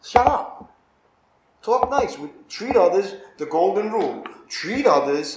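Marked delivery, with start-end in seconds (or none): 0.0-2.2 louder, higher, snapping commands; sharp scolding. Real, not acted. Preacher to congregation, telling them to stop being sarcastic, loud sharp scolding. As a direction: scold them like a fed-up parent.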